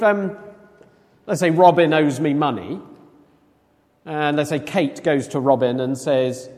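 usual speaking voice

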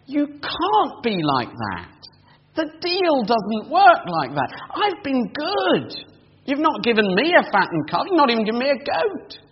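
wounded sense of unfairness; older brother's outrage. Retold or imitated. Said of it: "He's saying".

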